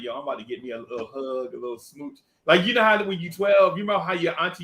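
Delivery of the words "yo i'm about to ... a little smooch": low soft, pleased with himself; low cocky murmur. Retold or imitated. Boy in the alley quietly hoping for a kiss. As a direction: savour it quietly. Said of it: "I'm like".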